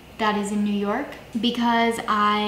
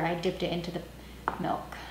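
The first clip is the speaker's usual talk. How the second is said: whisper; 4 semitones lower, 11 dB quieter, faster than usual. Real, not acted. Whispered realization of mistake.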